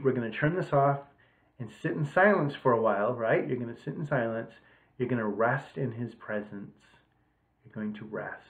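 usual speaking voice

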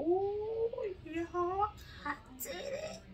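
high, bright squeal of delight; excited squeal. Real, not acted. Baker thrilled her matcha cake came out, high excited squeal.

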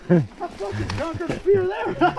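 high, slow, elated exclaim. Real, not acted. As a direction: savor the triumph, thrilled. Elated after big jump.

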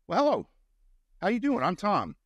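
high, lilting impression of a friendly hello; friendly greeting, imitated. Retelling Tom's friendly greeting.

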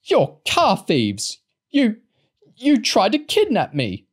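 slow, high, loud accusing shriek; old woman accusing Lauren of kidnap, shrieking; furious accusation. A narrator's reading. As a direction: hurl the blame at her, high and loud. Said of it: "pointed accusingly at Lauren".

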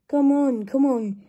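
loud, effortful urging; puffing. A narrator's reading. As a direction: puff it out while hauling coaches. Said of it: "puffed Gordon to the coaches".

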